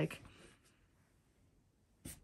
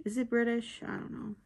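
usual speaking voice

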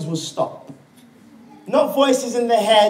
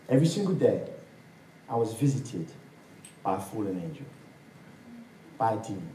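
hushed eerie telling; 11 semitones lower, 9 dB quieter than usual. Retold or imitated. Retold eerie confession.

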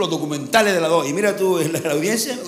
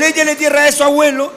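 loud taunt; 7 semitones higher, 6 dB louder than usual. Retold or imitated. Repeat of the taunt.

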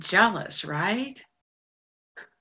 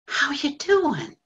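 pained echo; 6 semitones higher, slower than usual. Retold or imitated. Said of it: "ever since he said that".

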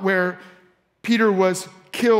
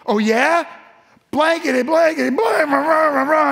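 high, heated stand-in swearing; mock cursing; 6 semitones higher, 4 dB louder than usual. Disciple swearing at his accusers to prove himself, mock-angry. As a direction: rattle off censored curses, high and forceful. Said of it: "began to call down curses".

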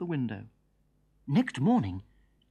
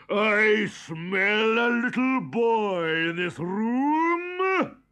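giant's voice; 6 semitones higher, 4 dB louder, much slower than usual. A narrator's reading. The giant sniffs out Jack.

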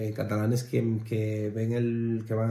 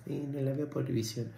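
imitando el cliché, rápido y bajito; cliché imitado; 3 semitones higher, 9 dB quieter, much faster than usual. Retold or imitated. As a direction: repite el tópico con burla suave. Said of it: "esto es un cliché total". (burla ligera del tópico típico)

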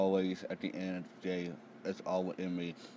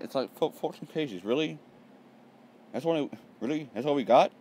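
higher-pitched, disappointed questioning; incredulous complaint; 8 semitones higher, 7 dB louder than usual. Real, not acted. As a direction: protest as if cheated. Disbelief at the short chapter.

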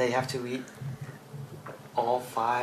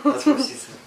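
laughing; 13 semitones higher, 9 dB louder than usual. Real, not acted. Joke after "put it in your mouth.

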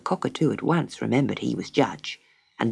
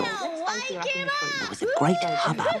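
high crowd of squeaky voices; a babble of tiny high voices; chattering animal crowd; hubbub; 7 dB quieter, slower than usual. Acted.

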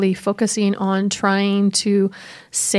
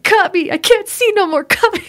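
much higher, pleading like a boxer begging his corner to cut his eye; a hammed-up fighter who can't see; beaten boxer; 10 semitones higher than usual. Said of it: "like, a physical match".

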